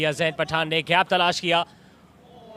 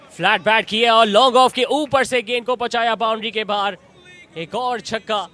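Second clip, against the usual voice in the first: louder, higher call of the six; excited cricket commentary; 4 semitones higher, 7 dB louder than usual. Real, not acted. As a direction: ride the ball over the rope. Another six called live.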